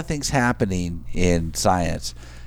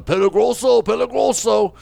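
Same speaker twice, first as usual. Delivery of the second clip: mother bear yelling; 10 semitones higher than usual. Retold or imitated. Mother bear freaking out at the cage, yelling "Peligroso".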